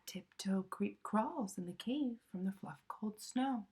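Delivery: ordinary delivery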